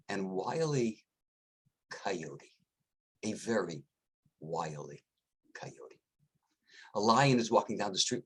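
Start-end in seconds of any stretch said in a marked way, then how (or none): 1.9-3.8 sly whisper. Acted. Teller whispers the wily coyote. 4.4-6.0 sly whisper. Acted. Teller whispers the wily coyote.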